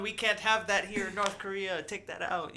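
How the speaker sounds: mock dictator voice. Voicing Kim Jong-un.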